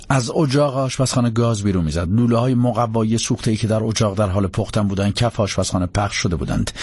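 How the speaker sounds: ordinary delivery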